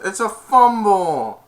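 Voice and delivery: high, loud, stretched-out call; imitated commentator's shout. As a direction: call the play like an excited announcer. Retold game commentary.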